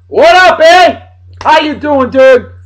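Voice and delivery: high, loud, thrilled hey-buddy call; excited greeting. Real, not acted. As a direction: greet him like a long-lost friend, loud and high. Excited shout-out to friend Rory.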